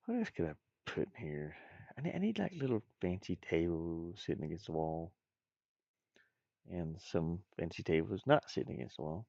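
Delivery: ordinary delivery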